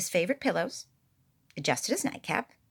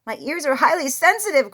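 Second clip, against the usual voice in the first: higher, louder protest; crying out; 7 semitones higher, 9 dB louder than usual. A narrator's reading. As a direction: protest loudly in exasperation. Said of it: "cried Bear".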